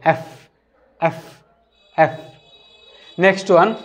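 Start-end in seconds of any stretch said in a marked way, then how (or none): 0.0-2.1 "এফ" with lip under the teeth, air pushed out; letter F demo. Shown as a demonstration. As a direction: breathe out through "এফ". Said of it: "এফের উচ্চারণ এভাবে করতে হবে". (দাঁতে ঠোঁট রেখে "এফ")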